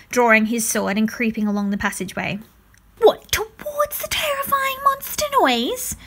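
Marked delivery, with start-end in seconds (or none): 3.0-6.0 high, slow frightened whimper; scared boy's whimper. A narrator's reading. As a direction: whimper it in fear. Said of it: "Wesley whimpered".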